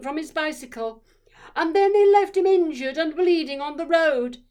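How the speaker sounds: furious mother shouting an accusation, high and loud; loud, high-pitched tirade in front of a classroom; angry shout. A narrator's reading. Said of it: "she shouted".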